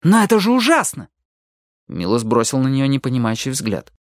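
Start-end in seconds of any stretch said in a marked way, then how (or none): 0.0-1.1 high, appalled exclamation; horrified protest. A narrator's reading. Ali objects to stealing people's bodies, horrified. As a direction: protest it, voice raised in pitch.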